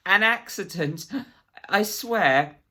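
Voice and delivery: high, loud, desperate pleading; panicked protest. A narrator's reading. Gasping Absalom swearing to the agent, loud and frightened. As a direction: cry out the denial in panic.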